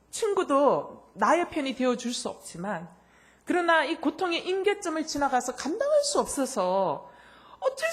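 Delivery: high, loud sermon build; impassioned preaching. Real, not acted. Preacher tells the congregation of unbearable pain, impassioned preaching.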